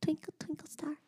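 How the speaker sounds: high, soft whispered request; toddler whisper. Retold or imitated. Mom imitating daughter at bedtime, whispered. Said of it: "And she goes".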